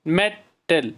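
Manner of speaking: "Metal" in two beats, मे, टल; a short gap in the middle of "metal"; syllable by syllable. Shown as a demonstration. Teacher repeating where the sound breaks, syllable by syllable. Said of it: "जहाँ से sound break होती है".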